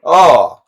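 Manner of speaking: sharp loud high "oh" of dismay; pained high groan. Real, not acted. Gamer reacts to a bad play, loud high groan. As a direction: cry out as the play goes wrong.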